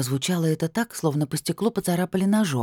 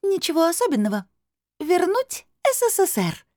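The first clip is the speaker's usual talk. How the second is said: amused crystal voice. A narrator's reading. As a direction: announce it teasingly in a high bell-like voice. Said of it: "хрустально засмеялся голос". (высоким хрустальным голосом, с усмешкой)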